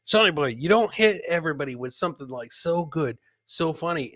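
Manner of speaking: usual speaking voice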